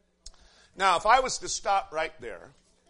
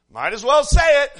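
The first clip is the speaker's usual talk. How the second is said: much louder and higher, rising burst; loud exclamation; 9 semitones higher, 14 dB louder than usual. Real, not acted. Preacher owning up to a startling quote, loudly exclaimed. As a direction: blurt it out at full voice.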